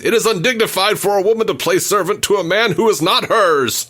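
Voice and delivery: high-pitched indignant yell; mock scream. Host quoting the soup-throwing outburst, mock screaming. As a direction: imitate an outraged scream, pitched well up. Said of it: "he screams".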